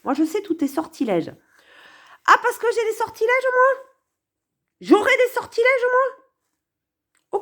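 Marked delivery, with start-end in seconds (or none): 2.3-7.4 high, offended mock outrage; indignant mock retort. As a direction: play the accused lover, outraged and much higher. Répond à Jacques comme l'amante. (indignation moqueuse, voix bien plus aiguë)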